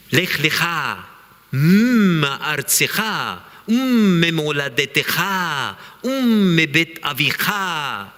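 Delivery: slow Hebrew reading, leaning on each echoing "-cha" ending; a humming pause before each phrase, savouring how the sounds rhyme; emphasis on the sounds. Shown as a demonstration. Teacher to students, showing the sound play of the Hebrew: slow, sound-stressed recital. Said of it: "put some emphasis on the sound".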